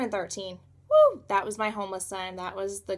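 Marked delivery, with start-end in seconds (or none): 0.9-1.2 sudden high, loud whoop; excited whoop. Real, not acted. Cheering about the wild year ahead, excited whoop.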